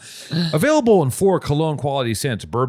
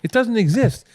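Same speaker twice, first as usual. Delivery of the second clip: mock pitchman; 9 semitones higher than usual. Mock pitch for deodorant wipes.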